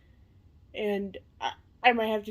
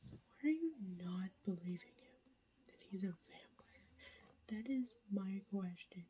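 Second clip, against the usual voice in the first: hushed late-night whisper to the camera; whispered update; 2 semitones lower, 15 dB quieter than usual. Real, not acted. Vlogger whispering a reading update at night, hushed whisper. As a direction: keep it all breathy and hushed so nobody wakes.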